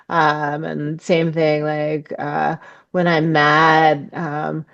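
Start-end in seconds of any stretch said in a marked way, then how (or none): none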